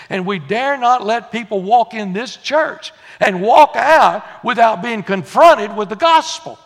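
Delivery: urgent, emphatic church address; fervent preaching. Real, not acted. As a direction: preach it at full force. Preacher builds to full force.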